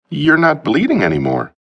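high, tense nervous voice; a jittery young man blurting it out; nervous high Martin. A narrator's reading.

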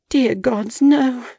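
desperate plea rising in pitch; begging the gods in horror; pleading. A narrator's reading. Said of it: "she pleaded silently".

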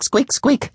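high little squeaks, lightly done; hamster squeaks. Acted. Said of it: "it came out as squeak".

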